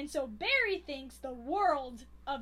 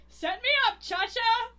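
higher, louder hammed-up swagger; mock cocky call; 7 semitones higher, 8 dB louder than usual. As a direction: call it out like a brash drunk. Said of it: "And then Zeb Zuber goes".

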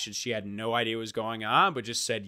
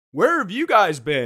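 higher and louder, mock puzzled; friends calling out; 6 semitones higher, 9 dB louder than usual. Friends at the bar, loudly puzzled. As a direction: friends wondering where they were. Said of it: "everyone was just like".

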